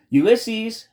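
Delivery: high, loud call out the window; shout. A narrator's reading. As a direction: call the name out to someone far off. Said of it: "Tootie shouted out her open window".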